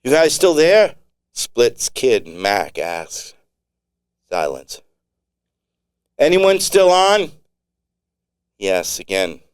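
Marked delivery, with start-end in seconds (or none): 0.0-0.9 high, loud radio call; calling out over radio. Acted. 6.2-7.3 much higher, louder call; calling out over radio. Acted.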